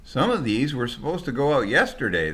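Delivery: high, loud protest; shouting back. Acted. Packers shouting back at the driver over the piles. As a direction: yell back in frustration. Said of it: "they shouted back".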